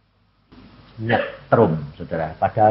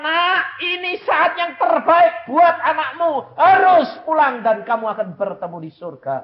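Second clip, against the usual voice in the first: voice of God; 5 dB louder than usual. Retold or imitated. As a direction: declare it as God speaking to the mother. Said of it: "Tapi Tuhan berkata".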